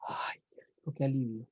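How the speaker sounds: soft whispered sigh of relief; relieved whisper. Retold or imitated. Someone who just threw up and feels better, relieved whisper. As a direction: breathe it out in a whisper, feeling relief. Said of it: "uno siente como".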